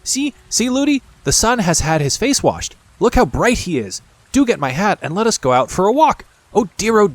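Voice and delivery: put-on high, bright girlish delight; excited child crying out. A narrator's reading. Princess calls to her nurse about the sun, excited cry. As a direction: squeal it out with joy at the sun.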